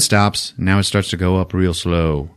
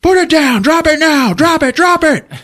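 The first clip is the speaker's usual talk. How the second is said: high, loud barked orders; shouted commands; 6 dB louder than usual. Retold or imitated. Podcaster voicing the mercs ordering guns down, shouting. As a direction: yell like mercs with guns drawn. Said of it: "they know how to handle this".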